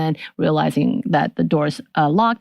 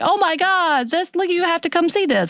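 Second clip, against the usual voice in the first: much higher, excited mock call; excited urging; 13 semitones higher than usual. Ellie calls Joel to come see, excited. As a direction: imitate an excited girl calling him over. Retold girl urging companion to look.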